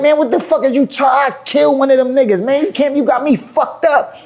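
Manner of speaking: very high-pitched heated outburst; retold anger. Artist refusing to calm down, indignantly angry. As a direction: replay an indignant threat, voice way up. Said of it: "now I'm getting mad".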